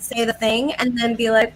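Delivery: ordinary talk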